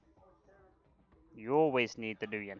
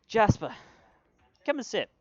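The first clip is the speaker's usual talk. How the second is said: boss woman; 10 semitones higher, 8 dB louder than usual. Acted.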